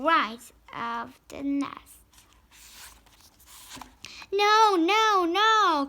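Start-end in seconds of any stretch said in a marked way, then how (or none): 4.3-5.9 high, loud pleading no; frightened cry. A narrator's reading. Parents call out to the duck, panicked cry. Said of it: "called out Mister and Missus Gray".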